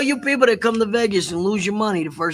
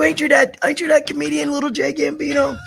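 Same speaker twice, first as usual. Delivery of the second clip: high, loud excited fan voice; imitating a fan; 8 semitones higher, 5 dB louder than usual. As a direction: call out like an excited fan spotting a celebrity. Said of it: "They go".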